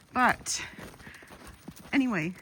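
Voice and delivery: ordinary talk, nothing marked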